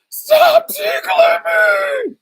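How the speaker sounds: very high, loud, slow sobbing scream; child screaming at the uncle to stop, crying; crying rage scream. Retold or imitated. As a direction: scream it through tears. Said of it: "it turned into a rage".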